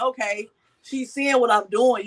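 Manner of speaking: ordinary talk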